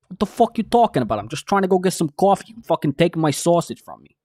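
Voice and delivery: loud, high New York rant; indignant New Yorker, New York accent laid on thick. Shown as a demonstration. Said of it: "a New York accent".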